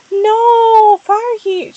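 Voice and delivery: high, loud anguished scream. A narrator's reading. Stargleam seeing Firestar caught, screaming. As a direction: scream his name in horror. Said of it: "she screamed".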